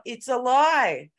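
loud, high, slow call-out; yelled heckle. Retold or imitated. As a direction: heckle the panel from the audience. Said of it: "And I yelled out".

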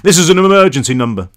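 loud officious call-taker; voice on the phone. A narrator's reading. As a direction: put on a stern switchboard man. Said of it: "the voice said".

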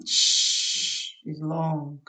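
"Sh" stretched out slow and drawn long. Shown as a demonstration. Teacher showing Spanish speakers how long sh lasts, slow demo. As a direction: hold the "Sh" like a long hiss, slowly. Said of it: "like this".

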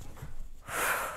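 long voiceless relieved sigh; relieved exhale; breathy sigh. Pretend. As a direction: let all the air out. Calming after sand shaking.

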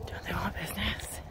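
unvoiced exasperated whisper. Real, not acted. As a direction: whisper the question. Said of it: "repeat as whisper".

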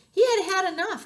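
high, loud fed-up narration; cross. A narrator's reading. Storyteller tells how owl got cross, loud exasperation. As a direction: tell it as if out of patience. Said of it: "he got very angry".

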